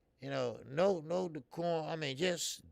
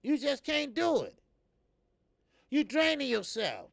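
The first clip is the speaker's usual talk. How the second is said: normal talking voice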